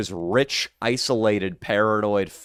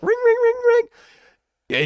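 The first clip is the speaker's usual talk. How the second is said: very high sung ring ring like a telephone; high squeaky telephone ring sound; phone ring imitation; 4 dB louder, much slower than usual. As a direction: chirp out a mock phone ringing. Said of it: "nightmares where it's like".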